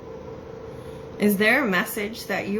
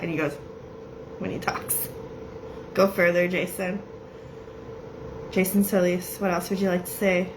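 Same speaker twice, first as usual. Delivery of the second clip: ordinary talk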